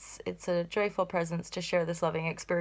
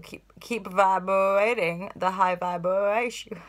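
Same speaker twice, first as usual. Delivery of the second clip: slow, stretched-out silly wobble; playful vibrating voice; 4 dB louder, slower than usual. Pretend. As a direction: draw the words out as if vibrating.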